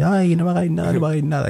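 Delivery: high, whiny mock plea for mercy; mock whimper. Hiding emperor begs the soldiers not to hurt him, mock whine. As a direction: beg like a cowering coward, hammed-up. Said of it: "así".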